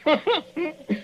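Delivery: high falling villain chuckle; a wicked little laugh; evil laugh. Acted. Villain laughing at captive doctor, evil chuckle.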